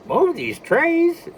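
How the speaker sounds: high, very slow falsetto; a cartoon mouse stumbling over the line; Mickey voice. Donald misreads, in voice.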